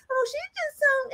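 very high mock crying whine; hammed-up high crying mock voice. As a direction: mimic a weepy gossip, squeaky high. Mocking someone's emotional reaction.

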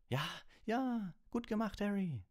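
very high, gleeful congratulation; Percy, delighted. A narrator's reading. Percy congratulating Harry on the win, delighted. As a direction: pitch it right up, thoroughly pleased. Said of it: "sagte Percy vergnügt".

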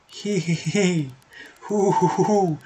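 high, drawn-out hee-hee and hoo-hoo; eerie hooting titter; villainous giggle. Acted. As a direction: taunt with a slow, high mock laugh. Unseen villain taunts in the dark.

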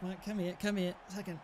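ordinary delivery

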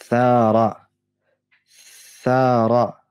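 "Thara" mit gelispeltem Th am Anfang; "Thara", Zunge zwischen den Zähnen; arabisch Thara. Shown as a demonstration. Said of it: "was haben wir denn da noch für ein Wort". ("Thara" mit lispelndem Tha-Laut)

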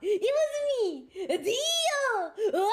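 high, loud hammy villain shout; screaming theatrical unmasking; villain reveal. Pretend. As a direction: bellow the big reveal like a cartoon villain. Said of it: "after I reveal my true form".

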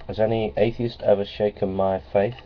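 ordinary talk

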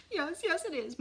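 high, upset, lightly sobbed answer; mildly acted sobbing. A narrator's reading. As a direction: answer as a tearful mother.

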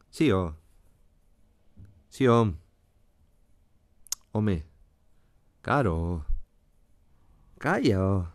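short interjections like "Claro" sliding down low; Asturian falling intonation. Shown as a demonstration. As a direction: give "Calla, oh" a steep melodic drop. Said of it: "característico descenso habitual que tenemos en la melodía". («Sí, oh», «Calla, oh» con entonación asturiana descendente)

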